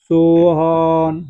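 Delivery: सोहन drawn out very slowly, vowel held long; stretched pluta vowel. Shown as a demonstration. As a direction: call सोहन long as if from a distance. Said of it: "थोड़ा सा खींचते हैं". (सोहन को लंबा खींचकर प्लुत स्वर में)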